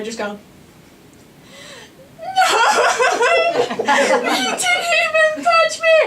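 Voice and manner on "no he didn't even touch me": high-pitched hammed-up wail; mock bawling to mom; full-on crying. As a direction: re-enact a sobbing meltdown, words stretched out slowly. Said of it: "like, full on crying".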